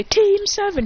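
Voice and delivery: high, loud rallying call; triumphant group shout; team cheer. Acted. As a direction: shout the team name proudly. Team cheering their name.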